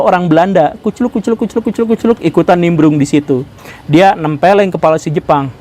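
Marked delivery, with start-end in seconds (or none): 0.8-2.2 high, light sound-effect chant; comic trotting patter. Retold or imitated. Mimics Dutchman trotting into the scene.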